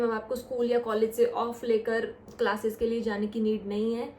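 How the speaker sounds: usual speaking voice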